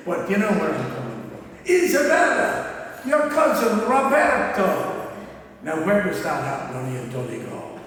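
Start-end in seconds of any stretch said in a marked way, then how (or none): 1.7-4.9 loud, high, slow mock Italian greeting; poor Italian accent. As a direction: ham up an Italian cousin's accent. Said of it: "that's a pretty poor Italian accent".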